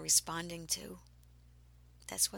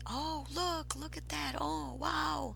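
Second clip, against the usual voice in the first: amazed onlookers; 7 semitones higher than usual. Retold or imitated. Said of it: "people in my group were going".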